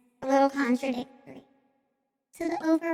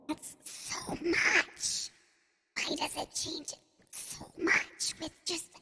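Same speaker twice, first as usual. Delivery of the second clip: altered voice effect; 8 dB quieter, slower than usual. Real, not acted. Voice effect changed by one tick.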